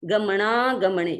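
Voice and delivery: गमनागमने slow, with णा joined to the next ग; गमनागमने slow, syllable by syllable. Shown as a demonstration. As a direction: link णा and ग in गमनागमने. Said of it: "तो शुद्ध उच्चारण हो जाता है".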